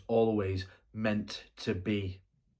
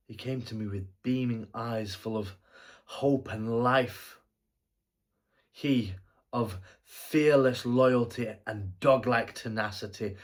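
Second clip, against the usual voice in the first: stirring praise; 2 semitones higher, 7 dB louder than usual. Acted. Fondly praising the dead boy.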